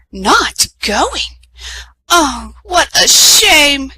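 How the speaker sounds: high, slow fake pity; sneering sympathy drawn out; mocking stepmother. A narrator's reading. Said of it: "mocking smile".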